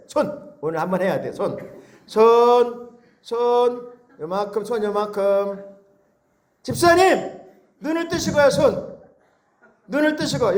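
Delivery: ordinary talk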